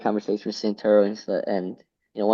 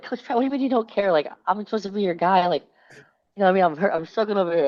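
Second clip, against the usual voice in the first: high-pitched, hurt pleading, re-enacted; emotional; 9 semitones higher than usual. Freshman protesting to coach who doesn't care, emotional. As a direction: plead like a wounded young athlete, voice pitched well up. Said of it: "I got really emotional".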